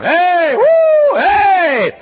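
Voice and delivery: very high, loud whoops; cheering yell. Retold or imitated. Fan yelling for his school at a football game. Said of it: "I was yelling up a storm".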